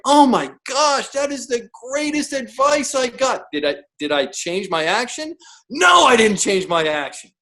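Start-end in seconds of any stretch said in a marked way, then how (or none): 0.0-3.4 mock amazement. Mock reaction to obvious advice.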